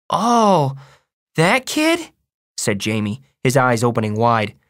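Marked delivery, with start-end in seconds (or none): 0.1-2.1 put-on high-pitched small boy voice; high, surprised little kid; little brother. A narrator's reading.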